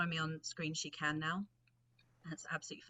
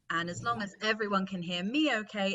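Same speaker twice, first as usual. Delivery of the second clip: normal talking voice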